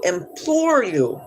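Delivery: "implore you" stretched out for emphasis; drawn-out plea. Real, not acted. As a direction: lean slowly on "implore". Mock-pleading with a commenter.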